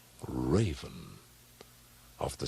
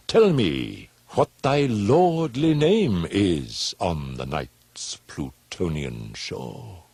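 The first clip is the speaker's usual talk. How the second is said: slow, weighty, louder; solemn address; 4 dB louder, slower than usual. Acted. Questioning the raven, slow solemn declamation. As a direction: intone each word gravely.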